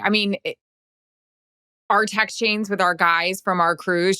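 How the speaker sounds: usual speaking voice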